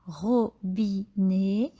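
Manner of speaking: robinet said slowly as ro-bi-net; teacher scanning a word for pupils; scanned by syllable. Shown as a demonstration. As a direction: beat out robinet one syllable at a time. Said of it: "vous allez scander le nom".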